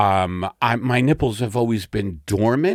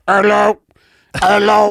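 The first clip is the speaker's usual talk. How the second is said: loud, high bullhorn hello; through a megaphone; 10 semitones higher, 7 dB louder than usual. Pretend. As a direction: test the bullhorn, calling out. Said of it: "I'd be like".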